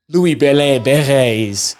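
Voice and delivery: put-on slow French accent on the name again; lightly French accent, admiring the winger's pace. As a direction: lean into the French accent, slowly. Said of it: "I've said that with the best French accent that I have".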